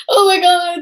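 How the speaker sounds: loud, high gasp of recognition; startled spotting him; mock shock. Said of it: "and I'm like".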